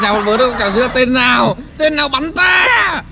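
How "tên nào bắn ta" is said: high, slow furious demand; angry shout. Acted. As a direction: roar who shot me. Victim shouts after being shot.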